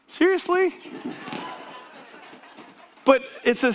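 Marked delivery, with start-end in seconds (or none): 0.1-0.8 high incredulous seriously; mock disbelief. X Games pros mocking our one eighty, incredulous. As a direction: scoff at the little trick, pitched way up. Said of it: "They must look back at us doing the one eighty and go".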